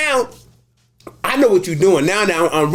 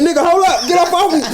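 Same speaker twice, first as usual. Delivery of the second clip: laughing mock panic; 11 semitones higher, faster than usual. Retelling being choked in cell.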